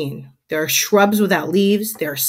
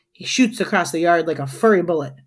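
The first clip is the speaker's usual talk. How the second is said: ordinary delivery, nothing marked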